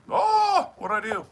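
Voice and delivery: sudden loud high yelp; startled. Real, not acted. Streamer startled by a button, loud high yelp. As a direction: jump as if something went wrong.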